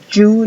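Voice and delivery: you said as "joo" with a hard j; you begun like the j of Joe. Shown as a demonstration. Teacher shows the sound needing J, you as "joo". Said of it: "necesitamos la J".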